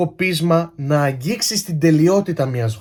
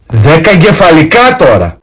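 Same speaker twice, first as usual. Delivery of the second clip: much louder, exasperated shout; exasperated outburst; 11 dB louder than usual. Acted. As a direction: blurt it out at full volume.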